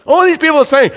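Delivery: loud, high, driving sermon voice; full-force preaching. Acted. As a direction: hammer each vice home from the pulpit. Preaching on works of flesh.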